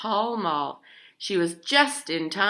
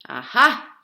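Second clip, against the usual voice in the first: a loud, triumphant Aha; triumphant exclamation; 4 semitones higher, 9 dB louder than usual. A narrator's reading. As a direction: crow it out like she's found the answer. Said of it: "she hawed".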